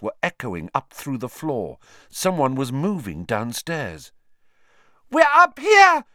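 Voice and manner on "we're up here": desperate high scream for help. Acted. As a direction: scream it at the top of your lungs. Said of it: "Hermione screamed suddenly".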